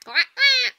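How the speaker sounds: playful high quacking; a child's mock duck call; duck quacks. Children making duck noises in play.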